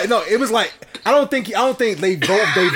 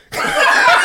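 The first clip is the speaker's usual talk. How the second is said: breathy high-pitched burst of laughter; 6 dB louder than usual. Real, not acted. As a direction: cackle out high and airy.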